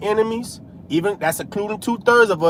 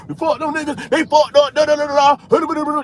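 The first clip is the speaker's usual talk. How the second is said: imitating a scoffing passerby a bit higher; mocking passerby; 6 semitones higher than usual. Preacher imitating passersby who diss him, mocking. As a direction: sneer it like a passerby dissing the preacher. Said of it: "They walk by".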